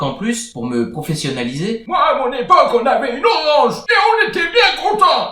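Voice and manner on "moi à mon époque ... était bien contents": put-on high, loud shouting old geezer; old grumpy man. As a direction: rant like a cranky grandpa.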